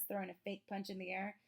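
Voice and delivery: usual speaking voice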